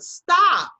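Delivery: sudden loud, high shout; yelled. A narrator's reading. Parents fed up with Ada's mess, yelling. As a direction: bark it out to halt the chaos. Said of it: "but her parents yelled".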